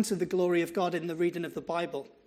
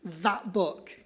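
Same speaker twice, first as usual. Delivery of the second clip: spat words. Retold or imitated. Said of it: "The way that he spat the words".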